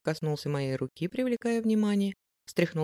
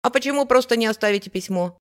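put-on loud, insistent kid; persistent boy; 4 semitones higher, 7 dB louder than usual. A narrator's reading. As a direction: keep pestering like a curious boy. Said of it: "не унимался мальчишка".

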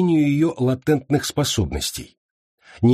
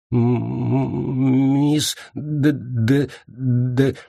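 stuck on the first sounds, stammering; trembling stammer; much slower than usual. A narrator's reading. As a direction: stammer out the name through trembling lips. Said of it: "губы дрожали".

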